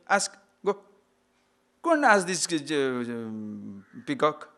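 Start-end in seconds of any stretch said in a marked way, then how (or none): none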